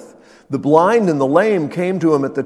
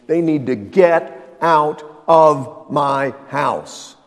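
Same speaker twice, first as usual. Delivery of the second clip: loud; 5 dB louder, much slower than usual. Pretend. Pastor repeating the order.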